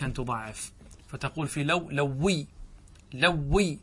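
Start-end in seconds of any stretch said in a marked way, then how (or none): 1.9-3.8 لوي with a held double w; doubled waw. Shown as a demonstration. Teacher showing students obligatory doubling, لوي with doubled w. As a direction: lean on the geminate w in لوي. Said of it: "بالتضعيف".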